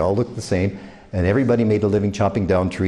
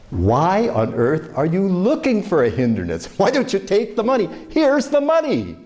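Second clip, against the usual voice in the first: exasperated; 8 semitones higher than usual. Retold or imitated. Traveller urging a stubborn clerk to take the money, exasperated. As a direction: plead in disbelief, pushing the money.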